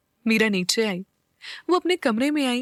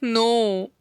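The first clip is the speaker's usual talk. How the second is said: drawn-out, grumpy "no"; sulky refusal. A narrator's reading. Neel refuses Meera's challenge, sulkily. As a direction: stretch the no in mild protest. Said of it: "नील मुंह बनाकर बोला".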